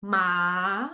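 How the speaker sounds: "Ma" starting low and sliding slowly up; second tone. Shown as a demonstration. Driving slowly to the top, "Ma" rising. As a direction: draw "Ma" upward from a low start. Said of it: "start very low and going to up".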